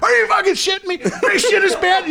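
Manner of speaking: high, loud mock disbelief; umpire's hammed-up shock. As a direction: gasp it out like the umpire can't believe it. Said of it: "He's like".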